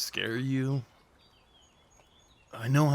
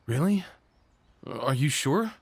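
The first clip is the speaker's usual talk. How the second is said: can't believe she said yes, pitch jumps up; surprised; 2 semitones higher than usual. Acted. To his date who agreed to a hug, surprised and delighted.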